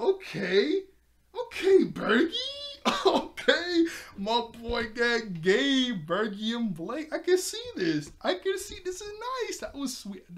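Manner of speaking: high, loud giddy squeals with laughter; squealing excitement. Real, not acted. Reacting as Bergie and Blake flirt, high giddy squeals. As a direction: gush over the new couple like a fan.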